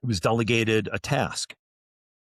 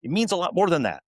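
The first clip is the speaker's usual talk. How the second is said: indignant lecture; 11 semitones higher, faster than usual. Retold or imitated. Scolding the lieutenant, retold.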